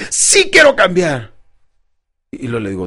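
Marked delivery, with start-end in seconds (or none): 0.1-1.3 slow, emphatic, with a smile; upbeat declaration. Shown as a demonstration. Coach has client stand and smile, upbeat. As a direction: draw out each word with conviction. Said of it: "sonríe un poquito y dígame".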